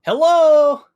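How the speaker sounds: loud shouted hello; hollering hello into the distance. Acted. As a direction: call out across the lava. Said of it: "He'll just shout out".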